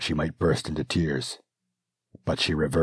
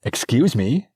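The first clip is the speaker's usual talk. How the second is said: louder, pointed, offended; sharper repeated interruption; 9 semitones higher, 7 dB louder than usual. Acted. Princess ignored by the servant, repeating herself indignantly. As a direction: repeat it, insisting on attention now. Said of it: "she said again".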